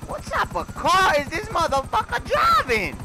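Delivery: high, slow yell at a car speeding off; incredulous shout. Real, not acted. Gamer shouting at a fleeing car in disbelief. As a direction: bellow it in disbelief as the car races away. Chasing a fast car in game.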